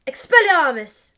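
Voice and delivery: loud spell cry; spell shout. Acted. Albus disarming Delphi again, shouting the spell. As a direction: fling the spell out loudly.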